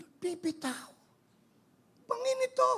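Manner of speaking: high-pitched, hammed-up panic; alarmed protest. Missionary hanging over a cliff answering God, mock panic. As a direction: squeal it in comic disbelief.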